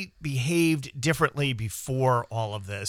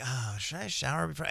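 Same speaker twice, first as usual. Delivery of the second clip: much softer, half to himself; quiet self-musing; 12 dB quieter than usual. Retold or imitated. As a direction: mutter the question sleepily to yourself.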